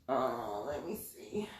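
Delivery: whispering to herself while checking; a soft whispered mutter; whispered aside. Real, not acted. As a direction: breathe it under your breath, unvoiced. Reading names, muttering to herself.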